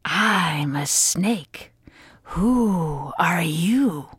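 slow, low snake whisper; hushed, drawn-out serpent voice. Acted. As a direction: breathe it out like a sly snake.

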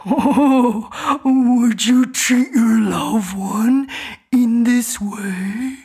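slow, pleading talking bear; gentle bear voice. A narrator's reading. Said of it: "the bear would say".

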